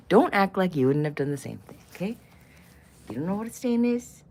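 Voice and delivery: ordinary talk, nothing marked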